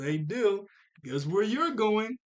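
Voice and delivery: lightly voiced eager Tommy; teasing good news. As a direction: tempt him with a surprise.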